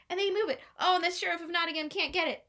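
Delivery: plain reading voice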